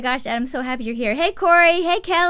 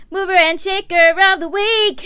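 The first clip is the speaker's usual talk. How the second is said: louder, higher announcer call of the title; announcing a segment; 5 semitones higher, 6 dB louder than usual. Acted. Host announcing her weekly segment to viewers in a showy voice. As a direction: sell the segment name like a show host.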